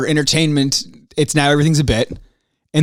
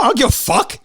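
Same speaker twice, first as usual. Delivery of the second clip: defiant, higher and firm; heated; 8 semitones higher than usual. Real, not acted. Host ranting at listeners who complain, heated. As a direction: throw it back at the haters.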